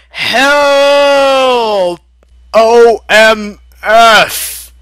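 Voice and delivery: high, loud, drawn-out cry for help; panicked yell. Acted. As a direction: scream for help in free fall. Character screaming while falling.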